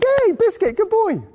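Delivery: put-on high, delighted praise for a baby; excited praise. Wife cheering the baby's first word, high and delighted. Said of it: "And she went".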